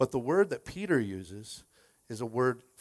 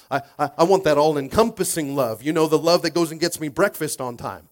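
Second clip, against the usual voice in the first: loud, insistent comic complaint; acted indignant spouse; mock demand; 8 dB louder than usual. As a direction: demand it loudly, playing it for laughs.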